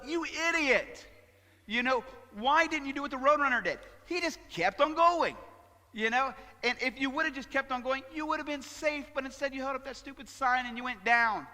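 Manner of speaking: high, loud exasperated yelling at a cartoon; mock scolding. Pastor mimicking his child self yelling at Wile E. Coyote, high and loud. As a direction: berate the foolish coyote. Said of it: "I would always think to myself".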